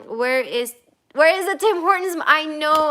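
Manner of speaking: ordinary delivery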